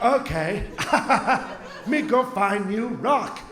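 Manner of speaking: slow, dim caveman grunt-talk. Retold or imitated. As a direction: play a simple caveman going for a rock. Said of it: "I become the caveman now".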